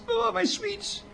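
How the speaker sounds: very high, soft little squeal; mock longing. Speaker pining for rationed sweets, high mock longing. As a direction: coo it softly, far above your own voice.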